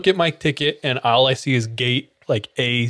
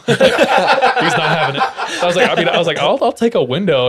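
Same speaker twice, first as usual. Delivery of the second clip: laughing; 5 semitones higher, 5 dB louder than usual. Real, not acted. Laughs heard.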